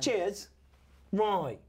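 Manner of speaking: repeated mock-Cockney "right"; put-on English accent, each word falling; Cockney-ish accent. Comedian agreeing with co-host, Cockney-ish accent. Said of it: "doing an English accent".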